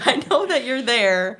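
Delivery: laughing through a pretend holler; exasperated mom. Mom answering son at the park, laughing exasperated yell. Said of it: "And I'm like".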